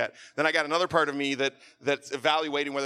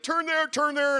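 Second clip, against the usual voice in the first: urgent call; 6 dB louder, slower than usual. Retold or imitated. Passenger urging driver to turn.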